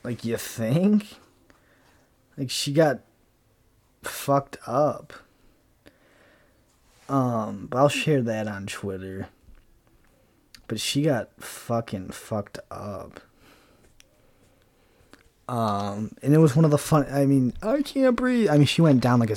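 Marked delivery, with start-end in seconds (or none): 0.0-5.1 sarcastic you think. Retold or imitated. Mocking the news anchor. 17.6-18.5 high, squeaky, hammed-up gasp for air; mock gasping woman. Mocking the fallen woman, in a high hammed-up gasp.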